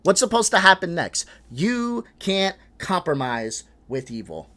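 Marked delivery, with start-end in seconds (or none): 1.5-4.5 each word of "You can't compromise with evil." laid down slowly, weight on "You"; slow weighted repeat. Shown as a demonstration. Streamer repeats the clip's line to viewers, slow and pointed. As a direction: drag out "You can't compromise with evil." to show how loaded it is.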